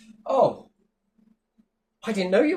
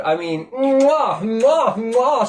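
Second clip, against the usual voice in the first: mock air kisses; 6 semitones higher, 6 dB louder, much slower than usual.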